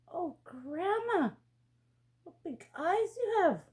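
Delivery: mild surprise at the big eyes; girl to grandma, lightly surprised. Acted.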